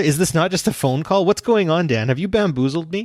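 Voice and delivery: ordinary delivery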